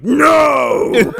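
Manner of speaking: booming mock-dramatic yell; big acted shout. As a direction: roar it like a movie hero. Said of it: "and he's like".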